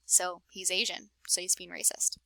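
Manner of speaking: soft unvoiced whisper; whispered aside. A narrator's reading. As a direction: tell the audience in a hushed whisper. Narrator's pointed aside.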